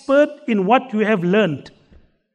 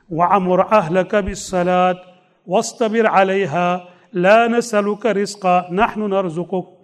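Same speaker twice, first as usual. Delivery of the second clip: fast recited Arabic verse; rapid Quranic recitation; 5 semitones lower, much faster than usual. Acted.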